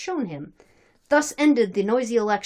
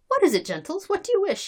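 higher, lightly voiced innocent question; old Cossack's inquiry; 11 semitones higher than usual. Old Cossack feigning ignorance to visitors, lightly voiced inquiry. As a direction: ask as if knowing nothing.

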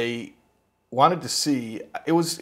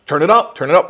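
much louder, higher insistent command; firm order; 5 semitones higher, 11 dB louder than usual. Retold or imitated. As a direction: push him to raise the voltage. Experimenter ordering more shock.

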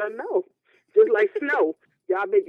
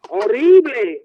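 loud drawn-out disgust; mock disgust; 4 semitones higher, 7 dB louder, much slower than usual. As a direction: really lean into horrible. Spanish listeners, exaggerated disgust.

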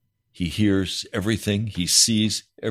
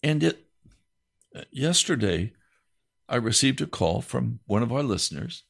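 ordinary talk, nothing marked

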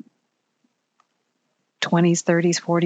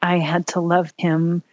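ordinary delivery

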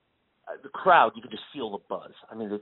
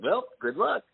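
teasing good luck; 7 semitones higher than usual. Retold or imitated. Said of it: "he was like".

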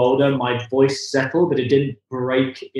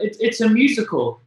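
mum quote; 9 semitones higher than usual. Retold or imitated. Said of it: "she went".

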